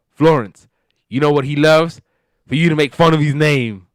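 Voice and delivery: high, loud sarcastic outburst; mock exasperation. [name] telling Florence off about the bodyguard's name, exasperated.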